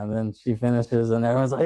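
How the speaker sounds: ordinary talk, nothing marked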